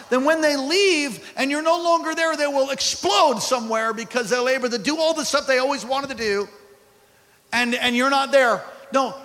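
rising pulpit fervor; fiery preaching. Acted. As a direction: preach it with full force. Preaching at full force.

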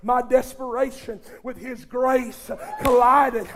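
climbing gospel preacher's roar; preaching at full force. Acted. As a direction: build from fervent to a shouted climax.

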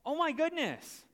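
mock worker counting his riches, voice climbing; excited. Preacher mimics worker's glee at the pay, high excited squeal.

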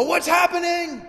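high startled shout; mock panic from the throne; panicked God. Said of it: "doesn't jump off the throne and go".

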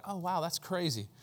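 underplay being impressed; mild amazement. Acted. Preacher imitates ordinary amazement, mildly impressed. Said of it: "it's not just like our normal".

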